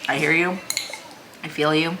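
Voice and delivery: normal talking voice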